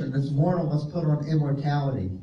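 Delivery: normal talking voice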